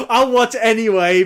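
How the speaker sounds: loud, high, through laughter; laughing. Real, not acted. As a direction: laugh it out loudly while talking. Laughing at own teasing remark.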